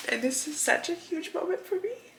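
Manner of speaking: really choked up, pausing to get through it; tearful pride. Real, not acted. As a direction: fight back tears while owning it. Tearful pride admitting a weakness.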